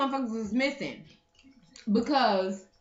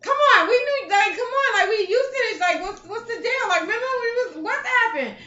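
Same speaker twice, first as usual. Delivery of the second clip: high excited nagging; 9 semitones higher, 4 dB louder than usual. Retold or imitated. Mimicking own urging WhatsApp message.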